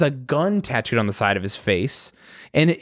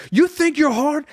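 high, aggressive mock yell; retold screaming; 9 semitones higher than usual. Going crazy on these kids, retold screaming. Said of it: "We're screaming at the kids".

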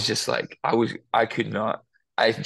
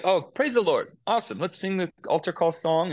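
routine praise; 7 semitones higher than usual. Retold or imitated. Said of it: "yeah, this is like".

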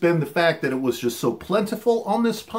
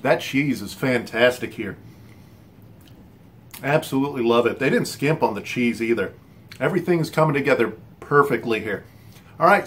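chewing between words; savoring while speaking; mouth full of food; 7 semitones lower than usual. Real, not acted. Seen 1: bit pizza at -12s, jaw working while talking, holding slice.

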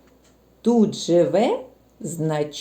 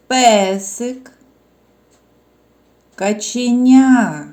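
louder, words called one by one; calling out words; 2 semitones higher, 5 dB louder, much slower than usual. Shown as a demonstration. Teacher calling out words for stress marking. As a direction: call out each word for the children to mark its stress. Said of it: "наголос у словах позначайте".

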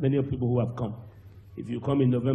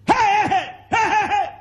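high piercing wail of hey hey hey, much louder; mock wailing cry; 9 dB louder than usual. Mourners wailing at the dead child. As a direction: wail it out like a grieving crowd. Crowd wails at child's death.

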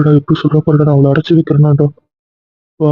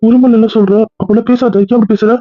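shocked high protest; 7 semitones higher than usual. Acted. Shocked father pleads with wife.

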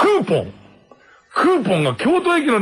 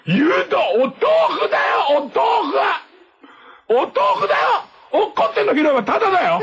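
高い声で笑いながら文句を言う; 八千円の豆腐を笑いながらぼやく; 笑いまじりの大げさな文句; 10 semitones higher than usual. Retold or imitated. お豆腐に八千円の文句. (高い声で笑い泣きのぼやき)

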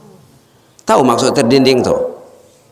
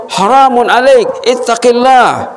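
high, slow and firm; stern forbidding; 11 semitones higher, 3 dB louder, slower than usual. Acted. The father refusing his daughter's embrace, high, slow and firm. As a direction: push her away with a warning.

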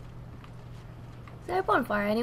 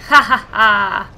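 loud, slow ha-ha laugh; triumphant laugh; 7 semitones lower, 11 dB louder, slower than usual. Real, not acted. Laughing after it worked.

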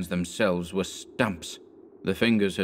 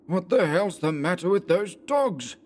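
higher, sharp cry at the dogs; savage cry; 10 semitones higher than usual. A narrator's reading. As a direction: snap at the fleeing dogs. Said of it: "cried Anderson savagely".

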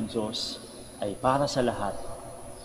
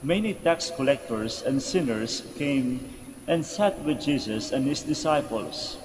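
slow, measured scripture reading; Gospel reading; slower than usual. Acted. Priest reads the Gospel to the congregation, solemn reading. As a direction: read scripture out in deliberate phrases.

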